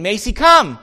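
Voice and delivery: loud, high, firm command; calling the dog. Retold or imitated. Owner calling puppy away from chewing, loud command. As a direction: call out sharply to a stubborn pet.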